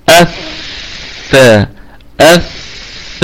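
الث- drawn out with a breathy hiss; الث- slow, soft and voiceless; whispered th. Shown as a demonstration. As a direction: let the air leak through the ث of الث-. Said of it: "صفة الهمس".

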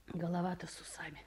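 contemptuous whispered aside; scornful whisper. Acted. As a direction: sneer it under the breath.

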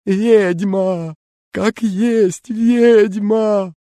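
high, slow terrified wail; terrified howl. A narrator's reading. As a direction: howl out in terror. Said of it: "и взвыл".

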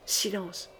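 very soft, low order for silence; hushed command. A narrator's reading. Queen to the four at the door, very hushed. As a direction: hush them almost under your breath.

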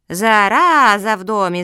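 loud, drawn-out boom; booming alarm. A narrator's reading. Big turtle entering the room to intruders, booming. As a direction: boom it out, stretching the words. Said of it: "загудела большая черепаха".

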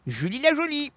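high put-on voice; imagined Julie. Guest gives her first name in the bar scene, high put-on voice. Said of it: "Et le prénom, c'est".

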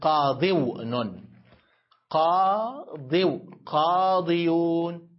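قاضون، قاضو، قاضيون drawn out very slowly, syllable by syllable; slow step-by-step word forms. Shown as a demonstration. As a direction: build each form slowly for students. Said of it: "بالعربي".

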